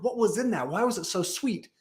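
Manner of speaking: a hammed-up celebrity chef demanding answers; mock Ramsay grilling. Gordon Ramsay laying into the kitchen staff, in mock disbelief. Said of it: "starts laying into these guys".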